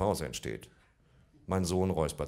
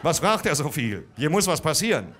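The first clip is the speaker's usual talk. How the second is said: loud, higher, fast exasperated inner rant; father fuming at the nosy butcher; 5 semitones higher, 5 dB louder than usual. Retold or imitated. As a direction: rant in rising irritation.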